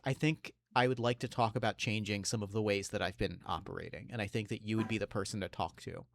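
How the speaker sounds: low, soft, measured voice; Batman. Acted. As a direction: speak low and quiet, choosing words deliberately. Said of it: "locks eyes with Duke and says".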